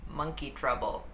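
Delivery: usual speaking voice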